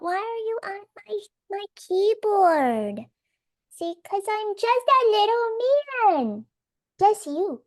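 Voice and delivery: high-pitched cooing scold to a cat; pet-talk voice. Real, not acted. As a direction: stretch it out high, fussing at your pet. Talking to cat on keyboard.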